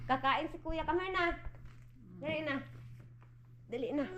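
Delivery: soft coaxing. Real, not acted. Mother softly coaxing baby to come to her. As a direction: coax the little one over softly.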